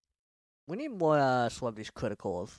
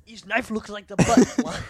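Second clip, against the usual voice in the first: put-on slow, loud, high German accent; 8 semitones higher, 10 dB louder, slower than usual. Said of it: "I realized this isn't German".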